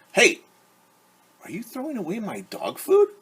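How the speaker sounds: high, loud, startled dog voice; the dog calling out, alarmed about his food; indignant dog. Acted. Dog protesting to the family, high and loud. Dog character shouts in story.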